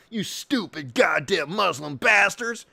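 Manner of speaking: ordinary talk, nothing marked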